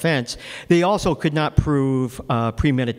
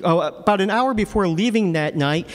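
ordinary delivery

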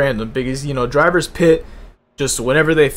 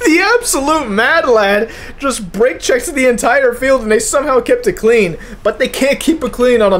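higher, slowed, amazed race call; excited disbelief; 7 semitones higher than usual. Real, not acted. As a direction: still reeling from the block. Still marvelling after the block.

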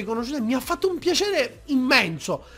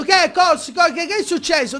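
higher, louder alarmed questions; mock alarm; startled; 9 semitones higher, 6 dB louder than usual. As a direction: caught off guard by a hugging fan. Startled reply to the girl.

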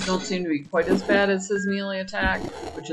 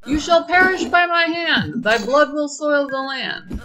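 booming mock villain, high and loud; hammy villain voice; 7 semitones higher, 5 dB louder than usual. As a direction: parrot the villain with big drama. Mocking the game villain line.

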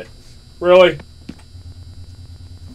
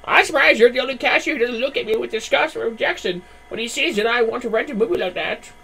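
high put-on customer voice; 9 semitones higher than usual. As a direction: confide shyly as a customer. Customer voice in game.

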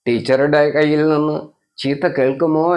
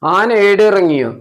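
mock amazement; 6 semitones higher, 5 dB louder than usual. Mother feigns amazement.

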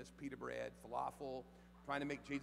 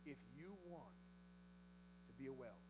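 hushed appeal; 3 semitones lower, 16 dB quieter than usual. Acted. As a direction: draw them in close, almost confiding.

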